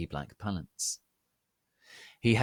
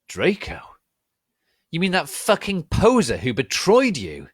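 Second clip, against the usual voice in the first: higher, louder scornful snarl; angry sneer; 9 semitones higher, 10 dB louder than usual. Acted. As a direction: snarl the question in contempt. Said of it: "with anger in his sexy voice".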